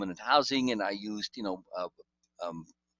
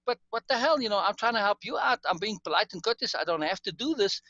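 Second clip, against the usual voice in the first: raised, indignant protest, high-pitched; irritated pushback; 10 semitones higher than usual. Retold or imitated. To Lace, after being told off: high, indignant pushback. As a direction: push back, he is only trying to help. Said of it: "I got a bit irritated, and then I kinda pushed back".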